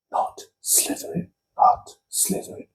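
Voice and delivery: urgent whisper, barely audible; whispered plea. Retold or imitated. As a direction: whisper it under your breath, pleading. Harry pleads silently with hat.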